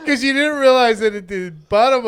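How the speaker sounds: words bubbling with laughter; laughing. Real, not acted. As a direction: laugh while telling it. Laughing through the screw joke.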